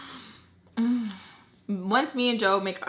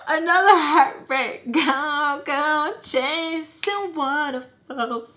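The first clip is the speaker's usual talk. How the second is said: hammed-up laughing wail, high and drawn out; mock heartbreak; 7 semitones higher, 5 dB louder, much slower than usual. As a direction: wail it slowly through laugh-sobs. Mock despair at hearing about Joe.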